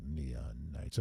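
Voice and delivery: deep, low late-night radio DJ; low smooth rock radio announcer; FM jock voice. Shown as a demonstration. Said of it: "I was doing my old progressive F M jock voice".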